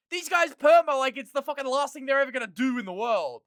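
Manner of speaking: high, loud exasperated yelling; furious high shout; shouting rant. Real, not acted. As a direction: rant at the top of your voice. Shouting rant about permas.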